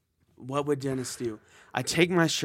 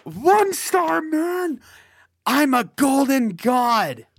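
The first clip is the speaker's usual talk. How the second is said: high, slow, indignant narcissist voice; Dennis outrage; 11 semitones higher, 6 dB louder, slower than usual. Acted. Player as Dennis, snubbed by the attendant, indignant high voice. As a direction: puff up and sneer in outrage.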